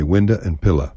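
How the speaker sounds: "window" as "winda", "pillow" as "pilla". Shown as a demonstration. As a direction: end both words on an a sound. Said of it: "switch out the surviving O for an A".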